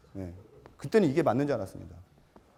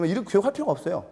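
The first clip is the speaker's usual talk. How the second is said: ordinary talk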